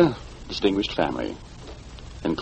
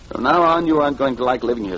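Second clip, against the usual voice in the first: 1 raised threat; 5 semitones higher, 3 dB louder than usual. Acted. Threatening the widow.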